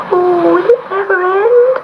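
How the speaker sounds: high, anxious little kitten voice; a frightened kitten wailing to itself; kitten's fretful thought. A narrator's reading. Storm-tossed kitten worrying aloud. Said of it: "thought Gobolino".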